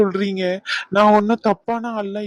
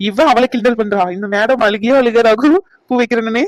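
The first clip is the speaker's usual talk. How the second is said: normal talking voice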